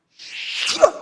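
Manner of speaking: shrill, high yelp of no; sharp refusal. Retold or imitated. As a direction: blurt it out in protest. Boy refuses the pastor. (목사님께 소리치며 거절하는 말투)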